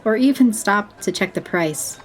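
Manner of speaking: usual speaking voice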